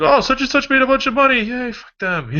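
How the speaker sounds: high, mocking jealous voice; sarcastic envy. Mocking envious onlooker.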